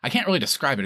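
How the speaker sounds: usual speaking voice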